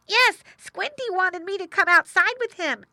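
put-on high, loud young pig voice; boy pig. A narrator's reading. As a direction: tell on your brother in a high voice. Boy pig Woof Woof tells parents.

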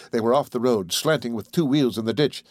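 usual speaking voice

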